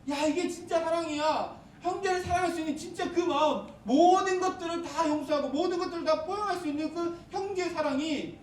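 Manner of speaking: fervent preaching. Acted. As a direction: lift it up, preach with fire.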